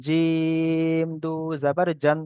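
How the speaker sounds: জিম held very long on an even tone; three-alif stretch. Shown as a demonstration. Teacher demonstrating the long letter জিম, drawn-out recitation. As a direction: slowly stretch the "ই" of জিম before দু জাবার জান. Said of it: "টানটা বেশি দিলাম".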